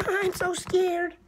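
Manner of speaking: high, slow timid squeak; mock scared. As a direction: play a frightened little toy. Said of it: "Bashful O's always scared".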